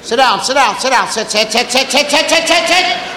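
rapid rattled-off sit, sit, sit; auctioneer rattle. Retold or imitated. Parent rattling off orders like an auctioneer. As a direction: chant it like an auctioneer. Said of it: "tobacco auctioneer".

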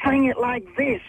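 high, coaching producer voice; playful impression of a producer; quoting the producer. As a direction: demonstrate it high like the producer did. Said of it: "he was like".

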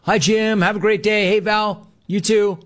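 lightly voiced cheery barista exchange; cheerful barista. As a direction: imitate the happy barista lightly. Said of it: "she's happy every freaking day".

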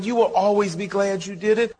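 ordinary talk

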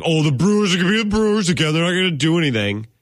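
scoffing impression of the national media; mocking national media. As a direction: sneer it like a smug pundit.

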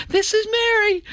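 a hammed-up mum chiming in on the family greeting; answering machine greeting. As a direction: squeak it out like the wife on the tape. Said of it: "everybody would leave a message, you know".

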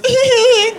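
high squeaky tee-hee; Doughboy giggle. Acted. Comic doing the Doughboy giggle. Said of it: "he goes".

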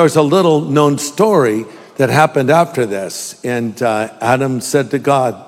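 usual speaking voice

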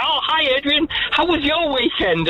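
high-pitched imitation of a cheery teacher; lightly mimicked small-talk hello; chirpy teacher greeting.

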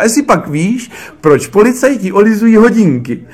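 high, loud, overeager question; mimicking an overexcited bad joke-teller; excited riddle-teller. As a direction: pose the riddle far too keenly.

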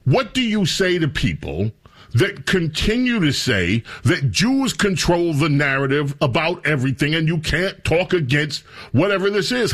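usual speaking voice

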